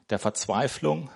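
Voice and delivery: ordinary talk, nothing marked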